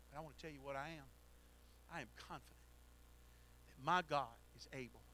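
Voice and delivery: ordinary talk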